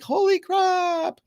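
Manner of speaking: high, slow panicked cry of holy crap; panicked exclamation. Retold or imitated. Body panicking over money, a high mock panicked cry.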